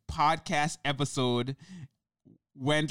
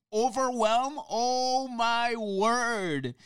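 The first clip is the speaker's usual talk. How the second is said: mock exasperation; 6 semitones higher, much slower than usual.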